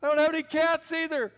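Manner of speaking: put-on high, loud comic old-man voice mishearing; deaf old man. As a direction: ham up the confused deaf man. Comic exchange continues.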